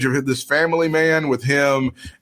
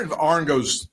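ordinary delivery

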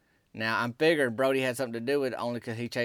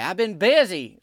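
a lightly voiced louder excuse; mild imitation; 4 semitones higher, 6 dB louder than usual. Husband answers his angry wife, mild imitated defensive excuse. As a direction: toss off the excuse a little defensively. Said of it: "like that away".

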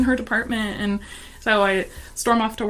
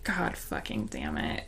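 much lower, softer, slow mock groan; husband's groan; 7 dB quieter, slower than usual. Husband hearing the pregnancy news, low groan. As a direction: sink low like a defeated husband. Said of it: "he literally just goes".

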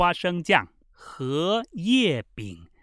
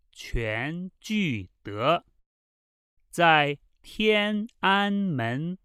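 slow, word-by-word dialogue reading with pauses; measured Mandarin, each phrase set apart. Shown as a demonstration. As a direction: read very slowly for learners to repeat. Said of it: "this time very slowly".

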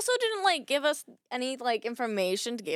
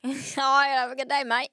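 jokey Australian sign-off; put-on Australian accent; 2 semitones higher than usual. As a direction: say it like a cheery Australian mate. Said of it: "I'm spe-, speaking in Australian accent".

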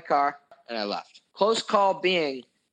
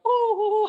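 high falsetto "ooh" in imitation; mock gleeful whoop; 4 dB louder than usual. Mimicking friends celebrating with the pizza, mock whoop. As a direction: whoop it high, mocking their glee.

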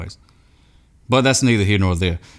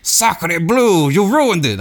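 a higher mock French accent, indignant; mock French outrage; 8 semitones higher, much slower than usual. Noodle aficionado scolding the Ragu user, in mock French. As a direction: play an offended French noodle snob, hammed-up in a French accent. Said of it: "I don't know why they're French".

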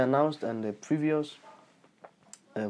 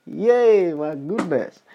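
delighted outburst; 5 semitones higher, 9 dB louder, much slower than usual. Real, not acted. Happy outburst after laughing.

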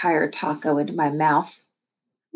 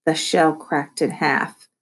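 ordinary delivery, nothing marked

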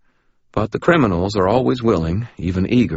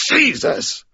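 pained exclamation. Acted. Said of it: "Ray said".

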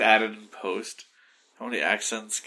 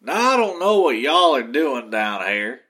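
loud Southern y'all voice; Southern accent; 4 semitones higher, 8 dB louder than usual. Pretend. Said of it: "Just be like".

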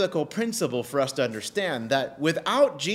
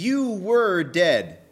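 "you were dead" slow and louder; stress on YOU WERE DEAD; 3 semitones higher, 5 dB louder, much slower than usual. Real, not acted. Preacher telling believers their past, slow heavy stress on "you were dead". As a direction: drive home "you were dead", stretching each word. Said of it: "You heard me emphasize it".

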